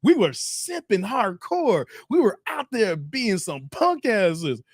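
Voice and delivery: slow, drawn-out confession; mock realisation. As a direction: stretch each word in comic dismay. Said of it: "we was like".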